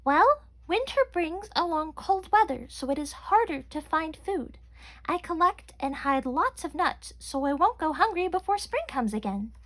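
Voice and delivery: high tiny chipmunk voice; squeaky chipmunk voice. A narrator's reading. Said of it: "Chelsea thought for a minute and finally said".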